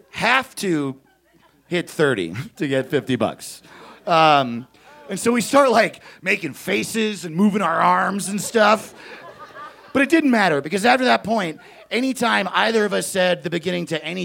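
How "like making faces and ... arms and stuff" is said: ordinary talk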